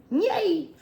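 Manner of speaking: high happy yay; real little cheer. Real, not acted. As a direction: cheer like you've got good news.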